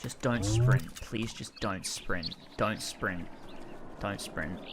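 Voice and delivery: quiet, tense begging; tense pleading murmur. Real, not acted. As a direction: plead under your breath, dreading it. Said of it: "I'm holding my breath".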